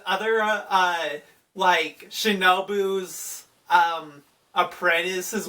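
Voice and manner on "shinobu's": ordinary talk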